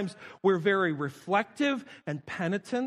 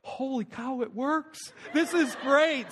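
delighted; 5 semitones higher, slower than usual. Retold or imitated. Pastor amazed the smiling works, rising delight. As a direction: burst out in happy amazement. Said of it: "I was like".

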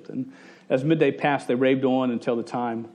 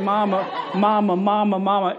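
put-on insistent, higher child's call, over and over; raised, repetitive kiddie pestering; imitated nagging kids; 5 semitones higher than usual. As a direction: play kids badgering mom without a break. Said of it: "And they're like".

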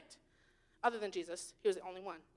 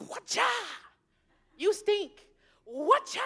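mock scolding God; 4 semitones higher, slower than usual. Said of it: "God's just waiting to be like".

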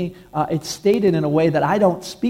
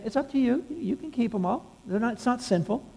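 softer, lightly higher reassurance; hushed, easy permission from God; the Lord speaking gently; 5 semitones higher, 7 dB quieter than usual. Acted. The Lord reassuring a believer about his toys, softly and gently. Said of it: "I felt the Lord saying".

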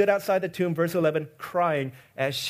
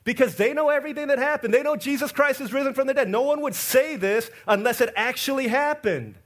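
ordinary delivery

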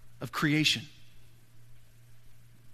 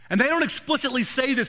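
ordinary delivery

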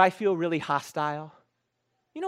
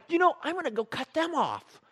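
much higher, scheming angry vow; hammed-up revenge threat; mock road rage; 12 semitones higher than usual. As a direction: play the vengeful driver. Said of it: "And I'm like".

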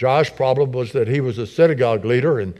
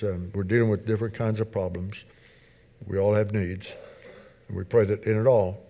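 public prayer. Real, not acted. Leads the congregation in prayer.

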